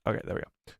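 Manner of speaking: breathy under-breath aside; whispered. Real, not acted. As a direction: whisper it to yourself. Quiet aside.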